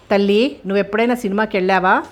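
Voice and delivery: ordinary delivery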